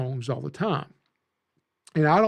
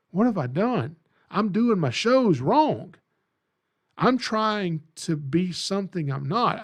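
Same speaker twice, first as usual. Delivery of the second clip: urgent realization; 5 semitones higher than usual. Retold or imitated. Sudden realization while driving home.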